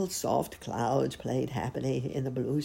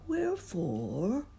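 slow, higher mournful question; sorrowful slow wondering; sad musing; 7 semitones higher than usual. A narrator's reading. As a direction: ponder it sadly, stretching it out. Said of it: "he thought sadly to himself".

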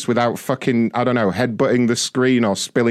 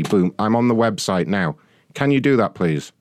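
usual speaking voice

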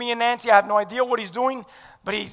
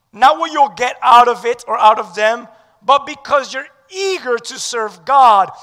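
ordinary talk

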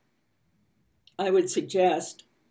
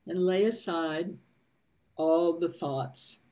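guided meditation reading. A narrator's reading. Reads meditation passage slowly.

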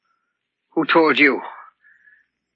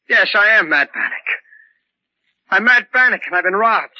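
louder, higher sudden claim; raised outburst; 6 semitones higher, 4 dB louder than usual. Acted. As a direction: burst out with a desperate claim. Patient suddenly asserting identity.

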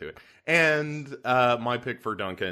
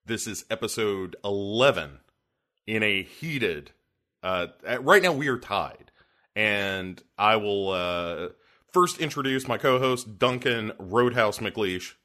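normal talking voice

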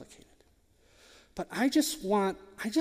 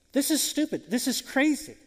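higher, scoffing; mock outrage at a silly command; scornful disbelief; 6 semitones higher than usual. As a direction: scoff at the prophet's order. Naaman's scornful reaction voiced.

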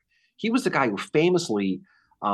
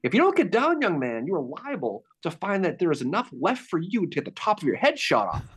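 high, sharp mock officer's bark; stern officer warning; 7 semitones higher than usual. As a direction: warn the young private like a stern officer. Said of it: "He goes".

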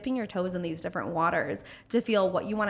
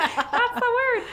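laughing exclaim; 6 dB louder, slower than usual. Real, not acted.

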